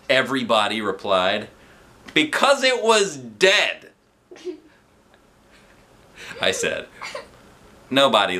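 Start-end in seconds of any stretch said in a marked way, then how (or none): 2.1-3.9 slow, high joke delivery; punchline. Acted. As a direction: land the punchline slowly.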